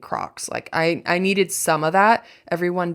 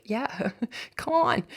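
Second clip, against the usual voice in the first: a mocking laugh then a slow, high scoff; dismissive scoff; 6 semitones higher, 5 dB quieter, slower than usual. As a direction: brush off the resume with a laughing sneer. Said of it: "and be like".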